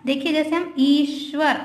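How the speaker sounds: ordinary delivery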